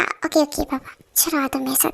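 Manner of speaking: hushed whisper to the wife; soft secretive whisper. Acted. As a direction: whisper it as if the baby could overhear. Husband leads wife to kitchen.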